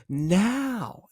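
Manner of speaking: "now" high and stretched out; long, lilting, questioning "now"; elongated word. Shown as a demonstration. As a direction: draw out "now" with a curious upward lift. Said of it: "I elongate the now".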